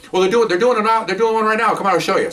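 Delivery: eager invitation. Retold or imitated. Doctors inviting officers to watch an autopsy, eager. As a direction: act out an upbeat come-and-see.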